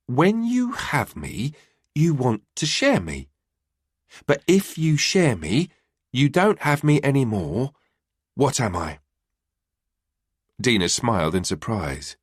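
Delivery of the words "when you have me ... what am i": put-on slow, high child voice reciting carefully; slow careful recital. A narrator's reading. Boy telling his mum a riddle, slow and careful. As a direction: say each part slowly so nothing goes wrong. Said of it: "spoke slowly to make sure he made no mistakes".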